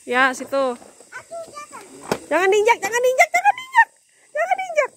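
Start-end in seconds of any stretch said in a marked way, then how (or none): 2.3-4.9 rising higher with each repeat, urgently; escalating high-pitched "don't" call; urgent warning. Real, not acted. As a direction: warn insistently, voice climbing very high. Urgent warning not to step.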